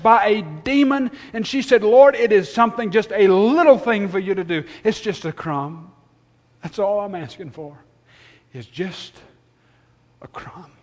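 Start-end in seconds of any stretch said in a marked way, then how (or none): 1.8-10.7 pleading, starting loud and fading to almost nothing; desperate prayer. Retold or imitated. Preacher voicing a woman praying for a crumb, desperate. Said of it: "her desperate situation almost praying".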